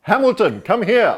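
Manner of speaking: high, loud mock bellow of a name; imitating a booming call. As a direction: summon him like a stern boss. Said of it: "No, no, Hamilton".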